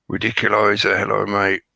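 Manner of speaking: normal talking voice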